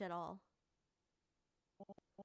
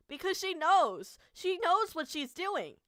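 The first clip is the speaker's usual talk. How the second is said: loud, higher, slow and accusing; furious shout; 8 semitones higher, 13 dB louder, slower than usual. Real, not acted. Realising the director knew all along, an outraged slow shout. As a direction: hammer it out in outrage.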